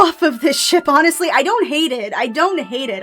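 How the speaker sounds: ordinary talk, nothing marked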